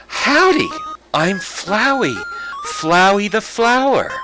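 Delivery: a cheery little flower greeting the player; Flowey the flower. Acted. Playing the cute flower greeting a newcomer, sweet and high. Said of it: "my superb voice acting".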